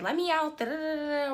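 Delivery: high mimicked demand running into babble; mocking imitation. Imitating the passenger wanting out, mocking high demand.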